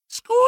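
very high startled squeak; high mousy squeak of fright; frightened squeak. Acted. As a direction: squeak like a scared mouse. Narrator turns, sees monster.

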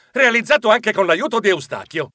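ordinary delivery

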